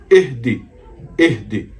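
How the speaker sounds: इहदी with the ha closed, no vowel; silent ha. Shown as a demonstration. Teacher showing the correct form, ha kept silent. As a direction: keep the ha in इहदी without a vowel. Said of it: "इह पढ़ना है".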